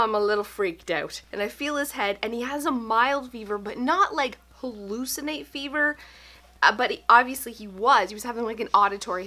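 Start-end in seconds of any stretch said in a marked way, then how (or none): none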